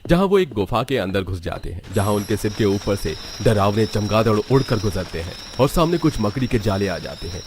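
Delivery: low, even storyteller voice; calm narrator reading a spooky tale; story narration. A narrator's reading. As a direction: set the scene like a storyteller. Story narrator between scenes.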